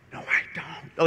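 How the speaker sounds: whispered mock-panicked denial; whispered protest. A priest put on the spot before the dad, whispered mock protest. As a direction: whisper it like a helpless aside.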